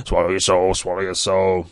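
loud muppet frog voice; punchy frog-puppet impersonation; Kermit the Frog. Acted. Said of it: "Full Kermit the Frog style".

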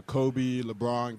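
usual speaking voice

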